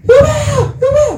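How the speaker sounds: high-pitched shriek of a name; acted scream. Preacher acts out Potiphar's wife crying out against Joseph, screaming. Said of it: "막 소리 지르면서".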